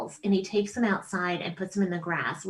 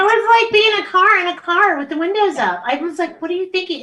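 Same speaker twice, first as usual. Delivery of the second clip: exasperated; 10 semitones higher, 7 dB louder than usual. Real, not acted. Furious retelling of gerbil death.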